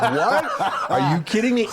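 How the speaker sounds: high, laughing amazement; can't believe the tip; delighted disbelief. Retold or imitated. Comic reacting to the manager's extra money, delighted disbelief.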